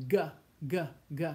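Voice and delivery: g said "guh", as in go; hard G sound. Shown as a demonstration. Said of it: "So G before A, O, and U sounds like".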